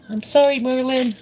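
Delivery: high, sweet voice to the cat; sorry to the cat. Real, not acted. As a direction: coo an apology to your pet. Apologising to cat after stepping.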